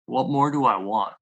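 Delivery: ordinary delivery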